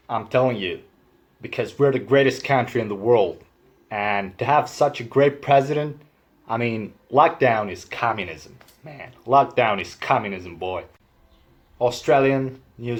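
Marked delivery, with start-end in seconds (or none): 0.1-10.9 patriotic American parody; drawled mock-American accent. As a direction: rant like an American lockdown sceptic.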